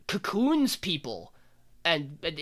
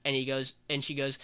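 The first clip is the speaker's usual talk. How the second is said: ordinary talk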